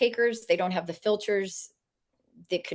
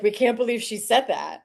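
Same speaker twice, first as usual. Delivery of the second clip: scandalized audience; 4 semitones higher than usual. Retold or imitated. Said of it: "you know, like".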